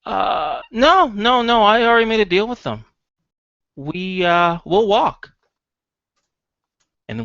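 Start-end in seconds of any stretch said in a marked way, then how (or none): 0.0-5.3 high, loud, hurried backpedalling; acted nervous son, pitched way up. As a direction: protest nervously in a raised voice. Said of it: "gives a bit of a nervous look".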